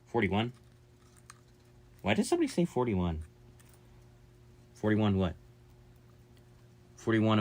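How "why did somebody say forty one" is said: ordinary talk, nothing marked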